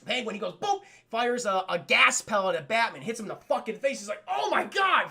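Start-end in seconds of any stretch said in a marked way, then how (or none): 4.3-5.1 much higher, loud cry of pain; hammed-up hurt shout; pained yell. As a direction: yelp it out high and loud. Batman hit by gas pellet.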